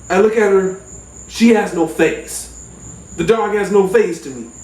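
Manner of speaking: ordinary talk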